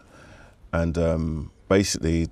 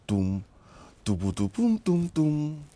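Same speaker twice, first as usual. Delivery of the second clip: vocalized bassline; 8 semitones higher, slower than usual. Retold or imitated. Imitating the tune's bassline.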